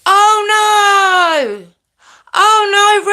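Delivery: high, loud, dismayed oh no; shocked cry of dismay. Real, not acted. Streamer reacts to a viewer's bad news, crying out in dismay. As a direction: gasp out real shock at the news.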